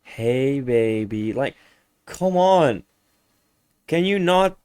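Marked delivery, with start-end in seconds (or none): none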